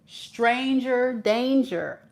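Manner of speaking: slow warning. Shown as a demonstration.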